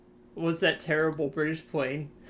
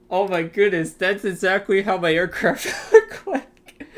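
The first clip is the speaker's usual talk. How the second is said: laughing excitedly; 3 semitones higher, 9 dB louder than usual. Real, not acted. Laughing at the picture.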